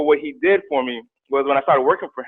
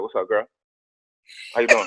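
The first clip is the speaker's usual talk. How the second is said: put-on low, soft, flat greeting to a girl; cool guy pickup line; 5 semitones lower, 5 dB quieter than usual. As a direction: play it cool with the date. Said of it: "My first date was like".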